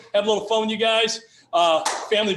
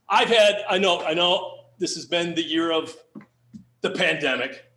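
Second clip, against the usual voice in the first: normal talking voice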